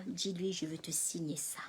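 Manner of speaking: soft unvoiced whisper; the lady whispering she will sign. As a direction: murmur it close. Lady's quiet offer.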